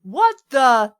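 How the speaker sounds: ordinary talk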